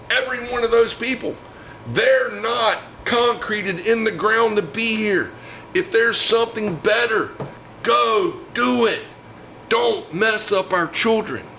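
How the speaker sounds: high-pitched, indignant, emphatic tirade; angry rant. Real, not acted. Guest telling the country's critics to leave our children alone, angry. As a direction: drive each phrase home angrily, pitched high.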